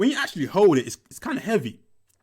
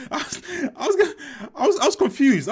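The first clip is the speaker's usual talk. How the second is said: breaking into high laughter mid-sentence; laughing; 12 semitones higher, 5 dB louder than usual. Real, not acted. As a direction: laugh through the words, can't hold it in. Laughing while recalling confusion.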